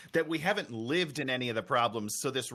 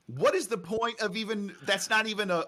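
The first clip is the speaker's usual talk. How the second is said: pitched-up indignant protest; real movie-fan exasperation; exasperated; 7 semitones higher than usual. Real, not acted. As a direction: protest in mad disbelief. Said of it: "I was so mad".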